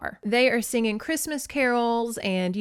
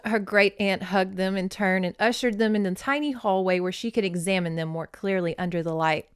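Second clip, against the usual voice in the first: ordinary delivery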